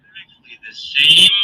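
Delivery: loud tinny microphone sound; like talking inside a tin can. Real, not acted. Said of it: "it's an even louder tin can".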